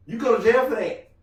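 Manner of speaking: ordinary talk, nothing marked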